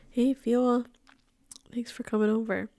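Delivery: soft unvoiced whisper. Real, not acted. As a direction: whisper a fond hello. Greets her dog softly.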